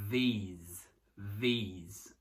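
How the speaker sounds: "these" with a long ee vowel and a buzzing Z. Shown as a demonstration. Teacher drills "these" with a long ee. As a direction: stretch the ee in "these", end on Z. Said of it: "E no plural é som de i mesmo".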